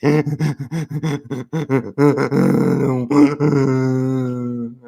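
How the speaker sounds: long stuttering fake sob; mock crying fit. Man showing a childhood crying state, drawn-out mock sobbing. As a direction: gasp and sob as if you can't get breath. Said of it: "such a crying state, you just couldn't breathe".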